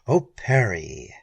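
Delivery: sad sigh. A narrator's reading. Keene sadly answers Perry's wild plan, sorrowful. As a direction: let it sink with regret. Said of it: "in tones of sadness".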